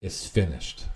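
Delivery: the Father whispering on the cross; whispered. Retold or imitated. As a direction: breathe it out as a whisper. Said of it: "the Father whispers".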